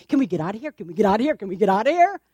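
nagging. Acted. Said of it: "tugging at his tunic".

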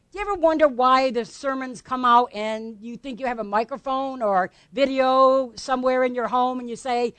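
normal talking voice

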